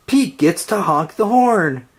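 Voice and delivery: ordinary delivery, nothing marked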